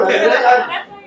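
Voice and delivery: ordinary delivery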